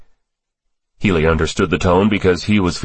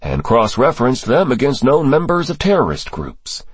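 assured answer in a higher register; unhesitating; 7 semitones higher than usual. A narrator's reading. Cross answering the senator on his sources, without hesitation, higher voice. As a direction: answer readily, with nothing to hide. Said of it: "Cross answered without the slightest hesitation".

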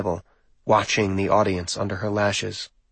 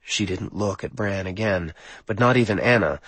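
ordinary delivery, nothing marked

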